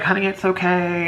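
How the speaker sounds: high, sugary comforting realtor voice; sweet realtor lady. Retold or imitated. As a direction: coo it like a kindly stranger. Realtor comforting crying Caroline.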